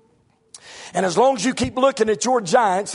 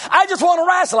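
a higher, lightly voiced son; son's voice; 8 semitones higher, 3 dB louder, faster than usual. Son asks his father why he won't wrestle, mild puzzlement. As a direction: play the puzzled boy asking his dad.

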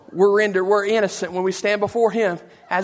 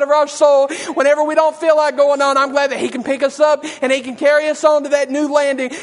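full-force preaching; 3 semitones higher than usual. Acted. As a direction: preach it out with everything you've got.

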